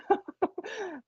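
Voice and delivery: high burst of laughter; real laugh. Real, not acted. Medium amused at own Cockney attempt, laughing high.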